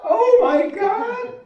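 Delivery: high, loud and slow in disbelief; astonished oh my God. Retold or imitated. Doctor imitating patient stunned by painless walking. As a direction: stretch it out in amazement. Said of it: "And she goes".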